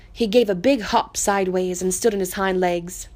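ordinary delivery, nothing marked

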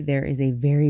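ordinary talk

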